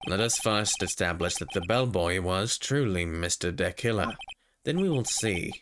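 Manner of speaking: low, condescending prosecutor. Acted. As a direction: drop the voice, smug. Prosecutor character voice.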